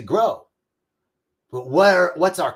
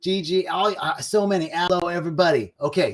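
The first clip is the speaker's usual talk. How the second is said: usual speaking voice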